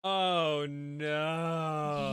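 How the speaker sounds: loud, high, drawn-out "oh no"; dismayed wail. Real, not acted. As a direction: wail it out, stretching the "no". Reacting to husband being Justin.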